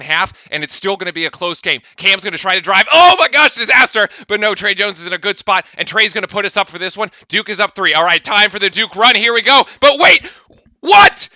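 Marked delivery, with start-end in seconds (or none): 2.9-4.1 sudden higher, louder gasp of alarm; alarmed call. Real, not acted. Commentator seeing a near turnover, alarmed. 9.8-11.3 higher, louder disbelieving shout; outraged disbelief. Real, not acted. Fan commentator seeing Duke down ten, shouting in disbelief.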